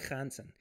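Jansen as "Khahn-sen", with a throat-scraped start; throaty first sound. Shown as a demonstration. Livestreamer showing the name's sound, guttural "kh" in Jansen. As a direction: put a guttural "kh" at the front of Jansen. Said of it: "You gotta put the into it".

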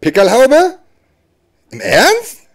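very high, rising incredulous questions; incredulous disbelief. Acted. Comedian doubting the audience's sign for police, incredulous. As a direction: shoot the pitch right up in disbelief.

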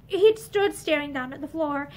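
usual speaking voice

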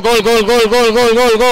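goal call. Real, not acted. Second goal called.

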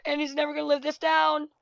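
ordinary talk

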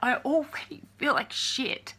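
choked up, near tears; breaking down; crying. Real, not acted. Telling the trolls she already feels bad, crying. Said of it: "Now I'm crying".

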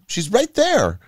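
put-on high-pitched eager little girl; lightly acted breathless excitement; breathless child. A narrator's reading. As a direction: point it out urgently, like a small child. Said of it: "Mia panted breathlessly".

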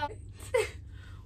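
a short, high breathless laugh; 轻声高笑; breathy high laugh. Real, not acted. Laughing at bike memory.

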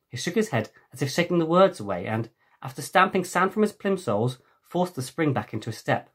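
ordinary delivery

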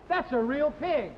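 usual speaking voice

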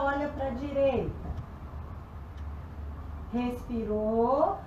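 soft, relaxed stretching guidance; quiet, soothing instructor; calm cool-down voice. Acted. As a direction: ease them down gently after the workout.

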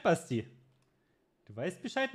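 usual speaking voice

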